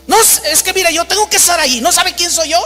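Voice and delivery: high-pitched, self-important protest; acted haughty indignation. Famous preacher pulling rank at the airline desk, acted haughty indignation. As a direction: pull rank on the clerk in mock outrage.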